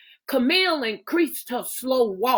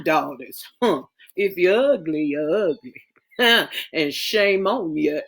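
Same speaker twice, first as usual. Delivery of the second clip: lower, fond, teasing mother's voice; a lightly lowered, fond Southern mother; proud mother; 4 semitones lower than usual. Acted. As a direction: admire your daughters and tease them. Mother figure voiced in story.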